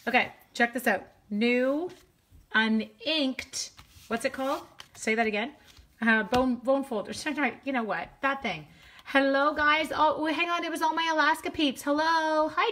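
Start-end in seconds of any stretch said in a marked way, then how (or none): none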